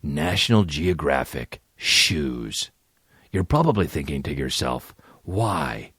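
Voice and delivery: low, slow parody commercial narrator; mock solemn ad voice. Host parodying a "times like these" shoe ad, mock-solemn. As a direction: intone it like a grave corporate message.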